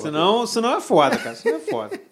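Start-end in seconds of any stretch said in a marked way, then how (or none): 1.4-2.0 laughing. Real, not acted. Laughing at the wrap-up.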